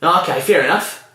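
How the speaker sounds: lightly voiced Aussie bloke, high and curious; old Aussie bloke. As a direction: play the old bloke coming round, pitch lifted.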